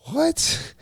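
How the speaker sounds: squeaky high disbelieving what; shocked what. Retold or imitated. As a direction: shoot the word way up in sheer disbelief. Aunt's shocking phone reveal.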